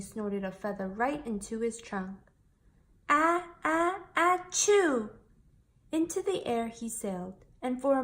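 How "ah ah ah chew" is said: a high, loud building sneeze, ah-ah-ah then a burst; big sneeze. Acted. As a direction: wind up and explode into a sneeze. Feather snorted up baby elephant's trunk.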